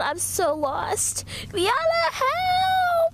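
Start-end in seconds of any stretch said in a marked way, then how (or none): 1.5-3.1 high, loud cry for help; desperate call for help. Acted. Lost, calling for help.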